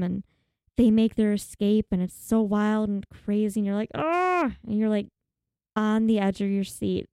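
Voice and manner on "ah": high, short mock scream of suspense; high-pitched acted "ah!"; mock viewer's yell. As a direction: let out a pretend scream at the film. Acting out a scared viewer's scream.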